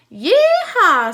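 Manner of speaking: loud, high yeehaw; cowboy whoop. A narrator's reading. Leroy cheers the butter order with a loud, high whoop.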